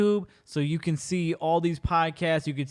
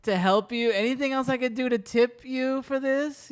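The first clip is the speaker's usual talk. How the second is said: flirty customer voice; 7 semitones higher than usual. Retold or imitated. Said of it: "he's thinking".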